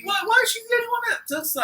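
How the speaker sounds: high, fast, loud baffled question; mock incredulous. Viewer baffled by the screaming victim, mock incredulous. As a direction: ham up the disbelief. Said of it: "and you sort of think".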